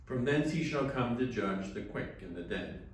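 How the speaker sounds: usual speaking voice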